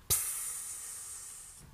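drawn-out quiet hiss; snake hiss. A narrator's reading. The Nameless answering God's accusation, hissing. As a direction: let out a long voiceless hiss. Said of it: "zischte das Namenlose".

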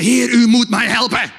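loud, urgent cry to God; desperate plea. Retold or imitated. Preacher re-enacts begging the Lord for help, desperate plea. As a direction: plead forcefully for help. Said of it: "Dat de wanhoop zo groot is".

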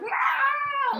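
high sudden yelp of shock; mock horrified shout. Shocked priest at the confessional crying out high. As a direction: cry out as if horrified. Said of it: "he's just like".

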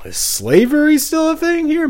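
high incredulous mocking voice; mock disbelief. Mocking disbelief about the neighbor.

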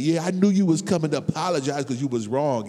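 loud, smug told-you-so voice; mocking the other driver's smugness; smug other person. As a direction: gloat like the one who was right. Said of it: "The other person may be like".

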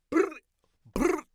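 short, high rolled brr noises; two loud, separate trilled bursts. Acted. Character punctuating his point about Rambo, loud trilled brr.

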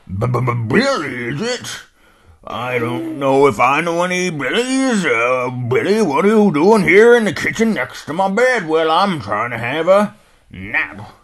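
put-on puzzled old dog grumbling; old hound dog. Old hound dog puzzling over the name, loud and folksy.

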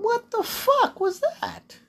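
squeaky high, slow disbelieving cry; stunned disbelief. Real, not acted. Viewer reacting to a sudden film kiss, stunned high cry. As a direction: voice jumps way up in shock.

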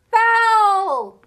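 a huge high crowd scream. A narrator's reading. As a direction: scream it in outrage. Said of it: "screamed the Gryffindors".

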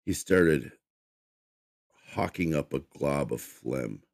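usual speaking voice

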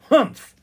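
loud, high huff of a sulky brother; sulky, emphatic "humph"; glum humph. A narrator's reading. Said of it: "Arthur seemed glum".

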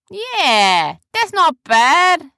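loud, drawn-out character voice; brash ship hand, pitched high. Acted. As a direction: call down grudging approval, slowly.

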